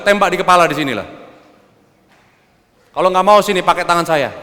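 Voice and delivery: loud, high, insistent challenge; worked-up angry urging; heated dare. Retold or imitated. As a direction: goad a wife in an acted-out row. Acted quarrel, daring wife to shoot.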